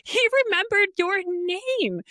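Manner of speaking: high-pitched thrilled gasp of a friend; imitated amazed friend. Friend points out Keith remembered her name, imitated amazement. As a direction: play the astonished friend, voice shooting up. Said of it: "So she goes".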